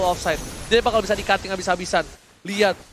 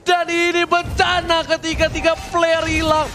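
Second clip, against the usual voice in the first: fighting game announcer; 8 semitones higher than usual. Acted. Hyped play-by-play during teamfight.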